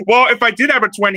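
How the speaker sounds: normal talking voice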